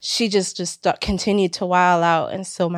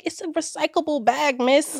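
dogging her; 7 semitones higher, 4 dB quieter than usual. Retold or imitated. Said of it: "dogging her".

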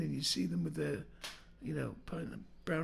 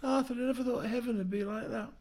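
tearful auntie voice; 7 semitones higher than usual. Acted. Auntie replying in joke.

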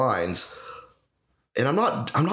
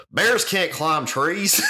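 mock kid line; 6 semitones higher, much slower than usual. Kid's line quoted mockingly.

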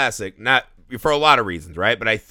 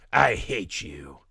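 slow, lower, small-child "I hate you" mimic; imitating a toddler; 7 semitones lower, 5 dB quieter, slower than usual. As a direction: play the two-year-old saying it flatly, slowly. Toddler quoted by his father.

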